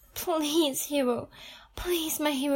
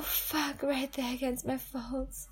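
ordinary talk, nothing marked

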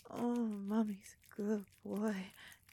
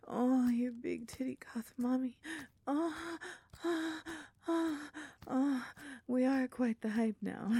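high, loud and breathless; moaning climax; 4 semitones higher, 5 dB louder than usual. Acted. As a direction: cry out in rising pleasure.

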